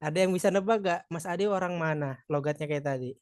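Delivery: ordinary delivery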